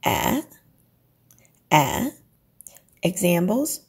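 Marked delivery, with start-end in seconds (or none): 0.1-2.1 A dips then rises like a question. Shown as a demonstration. Teacher demos the dipping question tone. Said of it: "your voice goes up like you're asking a question".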